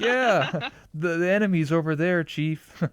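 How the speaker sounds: high, bright AI sidekick voice; girl voice. Pretend. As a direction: pitch way up for a female AI. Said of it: "I can't do a girl voice".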